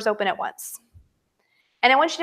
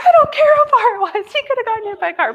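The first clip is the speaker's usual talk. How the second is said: loud, very high indignant cry; reenacted panicked protest to husband; retold scared outburst; 11 semitones higher, 8 dB louder than usual. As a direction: burst out high and fast, still rattled. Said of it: "it scared me that bad".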